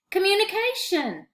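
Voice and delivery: high, excited exclamation; exclaiming. A narrator's reading. Paul answers Manny's riddle, excited exclamation. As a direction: shout out the answer with a eureka. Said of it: "Paul exclaimed".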